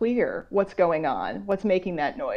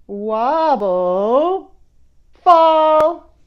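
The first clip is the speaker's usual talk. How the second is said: louder and higher, acting out a toppling tower; dramatic tower fall; 6 semitones higher, 8 dB louder, much slower than usual. Reading to kids as the tower topples, loud and dramatic. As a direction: let the tower wobble then crash. Tower wobbles then falls.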